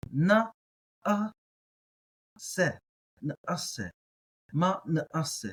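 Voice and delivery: ma, na, a, se with a clear catch before the a, syllables spaced out; guttural stop. Shown as a demonstration. Teacher sounding out the ayin syllable by syllable. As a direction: break the word with a glottal stop at the ayin. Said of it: "That's how I'm gonna pronounce it".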